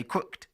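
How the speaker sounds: "crooked" said as one syllable, crookt; "crooked" rhyming with booked. Shown as a demonstration. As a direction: clip the -ed of "crooked" into a final -t. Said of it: "you don't say "crooked".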